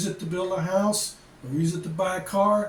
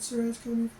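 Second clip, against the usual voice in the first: a higher, softer, timid little voice; meek nurse; 6 semitones higher, 4 dB quieter, faster than usual. Retold or imitated. Vlogger imitating the timid lab nurse, higher and softer. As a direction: lightly mimic a shy nurse mumbling to him. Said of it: "some little meek little Filipina, she doesn't wanna talk very loud".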